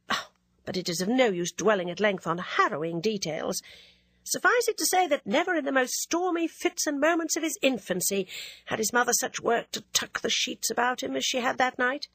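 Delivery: plain reading voice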